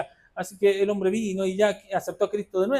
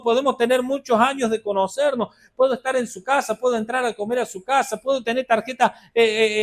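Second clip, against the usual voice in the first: loud, driving sermon delivery, pitch raised; fiery preaching; 6 semitones higher, 5 dB louder than usual. Acted. Preacher charging the church about honoring authority, fiery preaching. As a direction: preach it hard to the congregation.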